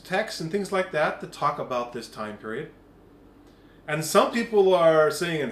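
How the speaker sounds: usual speaking voice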